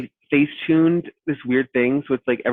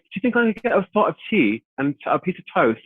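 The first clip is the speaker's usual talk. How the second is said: British guest; 7 semitones higher than usual. Retold or imitated. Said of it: "Was, was he British?".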